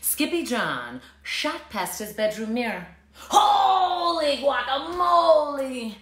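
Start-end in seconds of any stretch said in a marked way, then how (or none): 3.3-6.0 high, slow amazed cry; exclaiming. A narrator's reading. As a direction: gasp it out in wonder. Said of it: "exclaimed Skippy John Jones".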